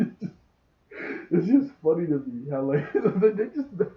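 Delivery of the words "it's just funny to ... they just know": high laughing words. Real, not acted. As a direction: squeeze it out through laughter. Laughing at the scene.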